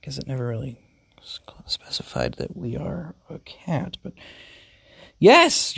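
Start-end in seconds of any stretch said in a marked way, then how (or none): 1.2-4.1 hushed whispered aside; whispering. Real, not acted. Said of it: "whispered aside". 5.2-5.8 high, loud happy yes. Acted. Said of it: "your owner says happily".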